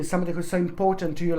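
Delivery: normal talking voice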